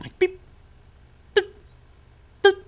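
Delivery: squeaky high electronic beep, beep, beep; high imitated beeps; high beeping imitation. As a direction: chirp the beeps way up high like a gadget. Imitating the Game Boy beeping.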